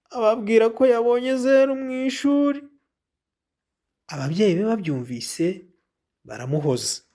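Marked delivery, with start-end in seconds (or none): none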